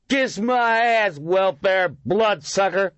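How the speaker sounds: put-on high, loud, very slow drunken yell. A narrator's reading. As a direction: drag it out, drunk and yelling. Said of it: "a drunken voice yelled".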